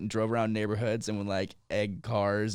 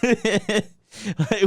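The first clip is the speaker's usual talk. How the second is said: ordinary talk, nothing marked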